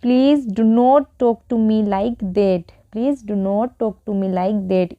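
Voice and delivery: very slow, each word spelled out; slow teaching read. Shown as a demonstration. Teacher reads slowly for students to repeat. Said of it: "तो उसके लिए हम क्या बोलेंगे".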